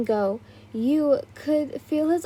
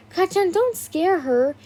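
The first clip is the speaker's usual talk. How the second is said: higher urgent plea; exclaiming; 6 semitones higher than usual. A narrator's reading. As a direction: cry out to stop him. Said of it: "Izuku exclaimed".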